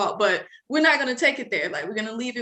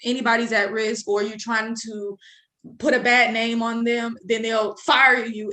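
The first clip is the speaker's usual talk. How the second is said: usual speaking voice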